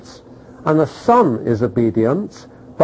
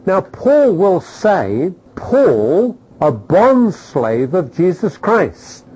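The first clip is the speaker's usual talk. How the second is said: slow emphatic statement; 2 semitones higher, much slower than usual. Acted.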